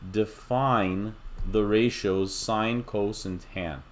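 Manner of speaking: define drawn out slowly, the whole phrase unhurried; stress on define. Shown as a demonstration. Teacher slowly stressing define before naming the ratios. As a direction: tell the class define, slow and leaned on. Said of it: "I'm gonna emphasize this word here".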